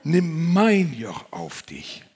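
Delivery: Jesus calling the listener, slow and solemn; solemn quote. Acted. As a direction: dwell on each word of the call. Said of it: "Aber Jesus sagt".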